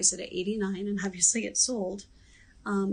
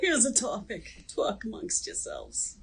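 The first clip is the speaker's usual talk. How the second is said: imitated New York accent. Host mimicking the SNL sketch in a New York accent. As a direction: linger on "Talk amongst yourselves" like Linda Richman. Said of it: "same character that would say".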